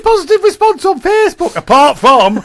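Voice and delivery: high, loud squeaky reading. Retold or imitated. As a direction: read it in a silly high voice. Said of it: "is this your weekend voice".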